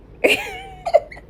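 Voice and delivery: high, giddy burst of laughter; giggly laugh. Real, not acted. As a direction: laugh it off, loopy from the meds. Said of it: "I'm high at this point".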